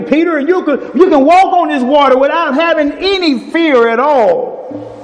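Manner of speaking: high, ringing preacher voice acting out Jesus and Peter; preaching at full force. Preacher retelling Peter on the water, fervent and high.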